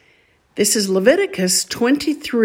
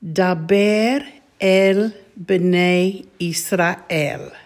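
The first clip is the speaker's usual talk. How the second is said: Daber el b'nai Israel, very slow and deliberate; slow, careful Hebrew chant-like reading; slow recitation; much slower than usual. Shown as a demonstration. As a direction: lay out Daber el b'nai Israel word by word for the class. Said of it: "these words all together".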